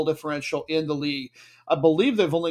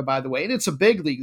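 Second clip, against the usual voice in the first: usual speaking voice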